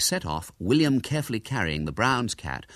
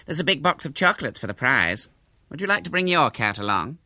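higher-pitched, innocent invitation; 6 semitones higher, 3 dB louder than usual. A narrator's reading. Hubert tempts William to enter his cat, innocent. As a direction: make the bait sound harmless. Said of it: "he said innocently".

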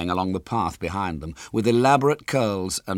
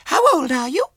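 put-on very high little girl voice; squeaky small child, louder; Violet Elizabeth; 7 dB louder than usual. A narrator's reading. Small girl questions her visitor, high and childish.

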